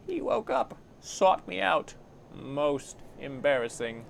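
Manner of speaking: high, drawn-out mock dismay; mocking reproach. Farid shames Anna over the living corpse, mocking. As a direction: needle her, one slow phrase at a time. Farid mocks Anna's mistake.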